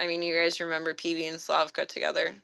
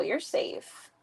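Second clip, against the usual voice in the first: whispered reassurance; 8 dB quieter than usual. Real, not acted. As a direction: whisper "You're safe." softly to the scared cat. Soothing a scared cat.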